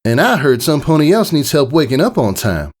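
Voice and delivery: dry, irritable retort; a touch of irritation, flat. A narrator's reading. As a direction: Applejack retorting to Rainbow Dash, mildly irritable. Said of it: "Her voice was flat, almost irritable".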